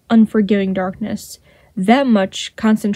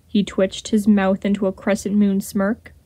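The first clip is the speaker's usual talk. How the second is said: ordinary delivery, nothing marked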